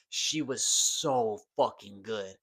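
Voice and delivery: normal talking voice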